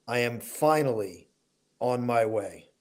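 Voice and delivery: ordinary talk, nothing marked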